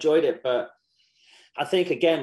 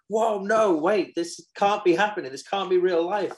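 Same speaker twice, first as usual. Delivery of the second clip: mock panic; 6 semitones higher, 4 dB louder than usual. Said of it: "So I'm like".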